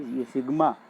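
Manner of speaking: ordinary talk, nothing marked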